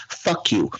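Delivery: ordinary talk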